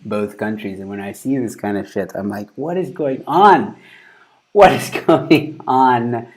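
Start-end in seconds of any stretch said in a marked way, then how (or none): 2.6-6.3 drawn-out, exaggerated bewilderment; mock disbelief. Streamer reacting to crazy clip, mock disbelief. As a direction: stretch it out, louder and incredulous.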